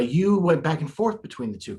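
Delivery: ordinary talk, nothing marked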